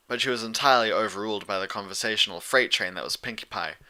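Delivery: plain reading voice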